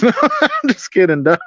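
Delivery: laughing it off; chuckling through the words. Real, not acted. Joking after a fake answer.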